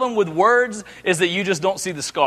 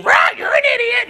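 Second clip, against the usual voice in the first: squawking parrot. Acted. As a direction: screech it like an angry parrot. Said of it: "the bird starts up again".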